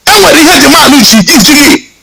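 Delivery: normal talking voice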